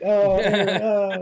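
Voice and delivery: high, loud cooing over him with a laugh; mock cheering. Friends cheering him on, high loud cooing. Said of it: "they were like".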